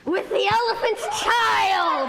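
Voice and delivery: spring the trap with a shout; pouncing crocodile roar. Acted. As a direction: crocodile grabbing the elephant child. Crocodile snaps and seizes.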